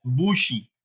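normal talking voice

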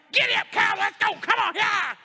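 high, loud coach's yell; urgent rallying shout; rousing shout. Retold or imitated. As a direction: fire him up like a coach. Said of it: "to spur you along is like".

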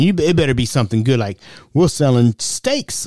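usual speaking voice

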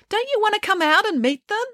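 high coaxing call through a door; bright, high calling voice; trying to sound cheerful. A narrator's reading. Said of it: "She was trying to sound cheerful".

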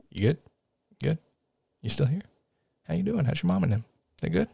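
hushed little aside; very soft, playful check on the listener; mock check-in. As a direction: drop right down as if checking in privately. Mock check-in during spoiler warning.

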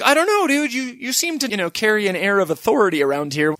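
high, hesitant sceptical voice; doubtful dog. Acted. Dog questioning Hitler, high hesitant doubt. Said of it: "the dog is like".

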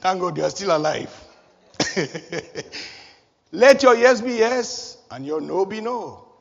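ordinary talk, nothing marked

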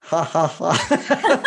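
slow, mock gloating ha ha ha; gloating laugh. Husband secretly gloats at his perfect wife, slow mock laugh. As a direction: savour the moment she slipped up. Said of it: "a part of me was like".

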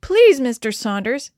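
loud, high-pitched begging; desperate child's appeal; pleading. A narrator's reading. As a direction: plead urgently with a grown-up. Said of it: "Denny pleaded".

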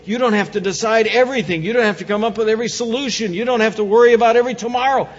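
building fervent sermon; rising preaching. Acted. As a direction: drive it home with mounting energy.

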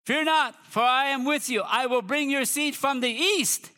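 high, ringing declaration of scripture; preacher commanding with a Bible verse; authoritative proclamation. Acted. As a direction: declare it with authority, drawing out the end. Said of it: "put some authority behind it".

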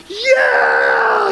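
high, loud whoop of relief; elated retold shout. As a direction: shriek it like the lost purse just turned up. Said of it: "she called me and she was like".

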